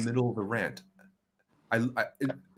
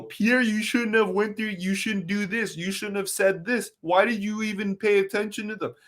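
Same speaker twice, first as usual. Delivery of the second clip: much higher mock-scolding voice; mocking critics; 10 semitones higher than usual. Critics scolding Pierre, mocked in a much higher voice. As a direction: parody the people lecturing Pierre, higher. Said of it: "everybody is willing to go".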